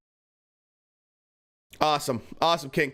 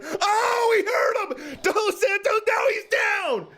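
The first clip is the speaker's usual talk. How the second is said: excited shout; 11 semitones higher than usual. Real, not acted. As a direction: shout it, fired up. Cheering a knockdown.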